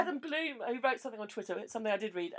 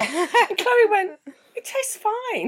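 laughing; 12 semitones higher, 6 dB louder, much slower than usual. Retold or imitated. Quoting Chloe laughing.

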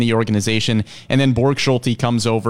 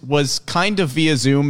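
ordinary talk, nothing marked

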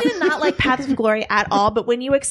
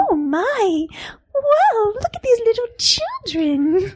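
princess voice; 9 semitones higher, slower than usual. Acted.

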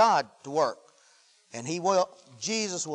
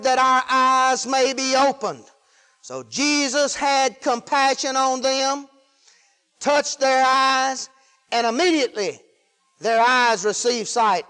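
sustained, chant-like declamation of the verse; preached scripture reading; 4 semitones higher, 4 dB louder, slower than usual. Real, not acted. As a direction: proclaim it with stretched, measured phrases.

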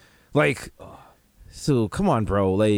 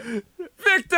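high surprised call; 12 semitones higher than usual. Retold or imitated. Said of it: "You're like".

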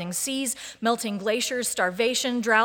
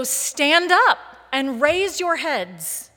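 loud, higher, slow exhortation with exasperated encouragement; teacher voice; 5 semitones higher, 7 dB louder, slower than usual. Acted. Jesus insisting amid chaos that God is near, in an emphatic teacher voice. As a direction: urge them up like an insistent teacher. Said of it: "using his best teacher voice in a tone that is equal parts exasperation and encouragement".

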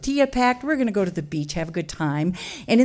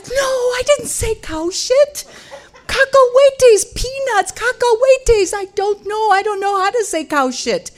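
much higher, louder, laughing protest; frantically insist, pitch way up; panicked denial; 12 semitones higher, 5 dB louder than usual. Retold or imitated. Panicked denial amid chaos.

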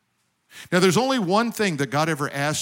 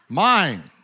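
put-on high, loud child's cry of mine; kid's shrill mine; 4 semitones higher, 7 dB louder than usual. Preacher mimics a toddler shrieking "Mine". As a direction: squeal "Mine" like a greedy toddler. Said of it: "they've got a pitch that reaches to the heavens".

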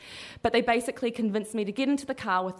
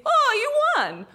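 high, slow, loud fake delight; mock surprise; 6 dB louder, slower than usual. Con man pointing at tourist, fake astonished cheer. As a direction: ham up the con man's staged surprise.

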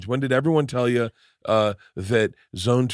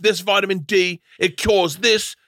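mock Australian accent; 6 semitones higher, slower than usual. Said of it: "he got an Austra-, Australian accent".

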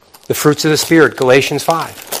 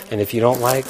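usual speaking voice

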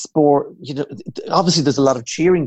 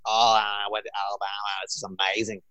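Americanized; 2 semitones lower, 6 dB quieter than usual. Acted. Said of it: "he's just totally Americanized".

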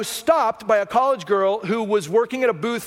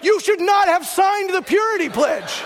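put-on shocked girl; 8 semitones higher, 3 dB louder, slower than usual. Girl reacts, shocked, told story.

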